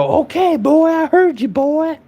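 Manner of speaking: very high-pitched mock southern holler; imitated southern screaming. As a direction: imitate a man screaming in a put-on southern accent. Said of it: "he started screaming and then".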